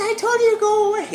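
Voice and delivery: high, loud woman shooing him off; annoyed woman behind the door. Retold or imitated. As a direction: shout go away through the door. Woman again through the door.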